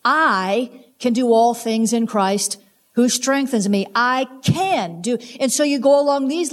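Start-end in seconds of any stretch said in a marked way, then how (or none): 0.0-3.8 "I" drawn out slowly, then a pause; stress on I. Shown as a demonstration. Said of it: "emphasizing a different word each time". 3.9-5.2 "can" stretched out and punched; slow down and lean hard on "can"; stress on CAN. Shown as a demonstration. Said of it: "emphasizing a different word each time".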